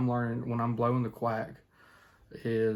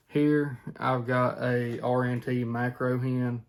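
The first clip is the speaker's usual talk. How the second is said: ordinary talk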